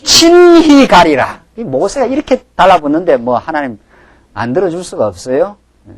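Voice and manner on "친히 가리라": high, slow proclamation of God's word; God's solemn promise. Acted. Preacher voicing God's promise to Moses, slow and high. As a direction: stretch it out, grand and lofty. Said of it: "여호와께서 가라사대".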